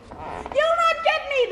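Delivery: high, slow teasing pig voice; defiant piglet. Acted. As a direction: taunt the wolf from safety, high and slow. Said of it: "the piggy cried".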